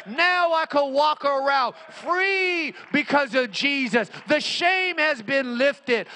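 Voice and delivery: high, ringing declaration; triumphant preaching. Real, not acted. Preacher declares shame gone through Jesus, triumphant preaching. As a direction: proclaim freedom at full force. Sermon climax, then lowered repeat.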